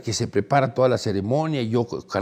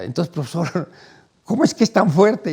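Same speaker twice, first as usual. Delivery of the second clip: higher, louder baffled plea; exasperated question; 6 semitones higher, 4 dB louder than usual. Retold or imitated. A young man begging the monk's secret, exasperated. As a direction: ask it again in disbelief.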